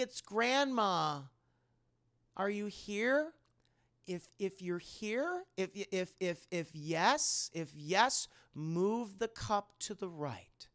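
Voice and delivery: slow, hopeful questioning with long pauses; acted slow séance voice; séance grandma calling out. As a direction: gently summon a grandchild's spirit, drawing the words out.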